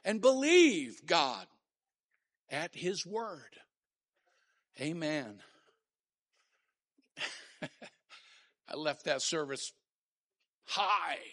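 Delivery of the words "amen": normal talking voice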